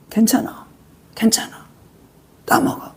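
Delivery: breathy whisper, coaxing; whispered urging. Acted. Satan whispering Eve to eat the fruit. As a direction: whisper it into her ear.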